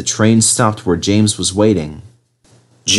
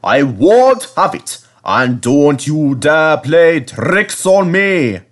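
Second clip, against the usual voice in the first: put-on very slow, louder, firm old engine; 6 semitones higher, 3 dB louder, slower than usual. A narrator's reading. Skarloey stopping the coaches telling tales, firm. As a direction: cut them off firmly.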